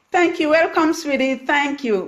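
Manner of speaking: high, loud, delighted thank-yous; bright thanks. Real, not acted. Host thanking live viewers, high and delighted. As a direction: beam at the viewers as you thank them.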